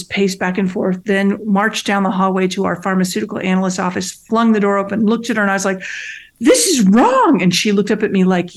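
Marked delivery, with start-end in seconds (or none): 6.4-7.4 high, slow, loud indignant declaration; each word drawn out in outrage; emphatic protest. Retold or imitated. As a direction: plant your feet and say it slowly and forcefully. Confronting analyst after marching in.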